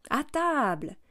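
cheerful host calling everyone to eat; brisk. Acted. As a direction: announce supper with sudden cheer. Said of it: "dit-il vivement".

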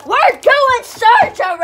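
loud declaring. Real, not acted. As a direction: announce it dramatically. Mock serious announcement.

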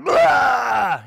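loud, high imitation of a screaming infant; mock baby wail. Lecturer imitating an inconsolable crying infant, loud mock howl. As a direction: belt out a sudden baby howl.

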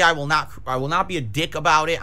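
ordinary delivery

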